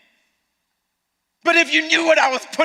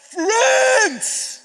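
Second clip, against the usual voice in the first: Jesus calling them friends; 12 semitones higher than usual. Retold or imitated. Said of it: "Jesus is like".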